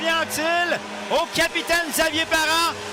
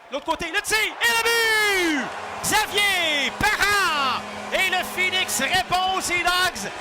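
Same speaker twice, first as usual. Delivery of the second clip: goal call. Real, not acted. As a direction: shout the goal.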